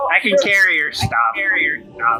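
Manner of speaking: goblin voice. Acted. Goblin offers to carry stuff.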